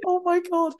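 gushing at how many came; amazed gasp. Retold or imitated. Retelling the crowd, high stunned awe.

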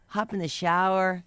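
usual speaking voice

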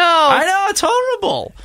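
playful over-the-top lament; mock despair. Real, not acted. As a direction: ham up the tragedy.

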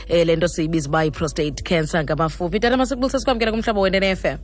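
ordinary delivery

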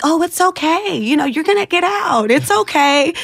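high, sing-song reassurance imitating the girls; consoling cellmates. Cellmates comforting a crying newcomer, high mock soothing. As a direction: mimic seasoned inmates soothing a newcomer.

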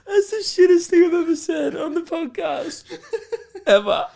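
high, breathless laughter through the words; helpless giggles, barely getting words out; crying laughing. Real, not acted. To the listeners, cracking up at own joke, crying laughing. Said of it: "I'm crying".

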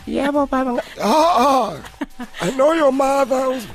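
put-on prank-call as a doddering old man. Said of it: "no one will be rude to an old man".

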